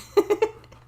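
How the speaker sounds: sudden high, loud laugh; burst of laughter. Real, not acted. Streamer laughing at her own tech fumble, high loud laugh.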